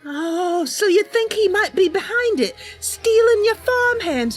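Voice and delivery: ordinary talk, nothing marked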